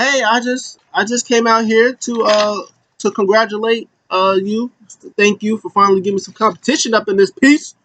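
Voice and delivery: high, hesitant imitation of a thank-you; mock congratulation. Wrestler dropping by to congratulate a rival, mock polite.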